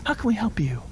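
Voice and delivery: breathy whispered sympathy; soft whisper. Retold or imitated. Pastor to single mom in office, tender whisper. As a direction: whisper it sweetly, like to a hurting mom. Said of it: "And I'm just like".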